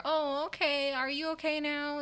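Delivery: high sympathetic asking; high concerned voice. Retold or imitated. Imitating her own concerned check-in.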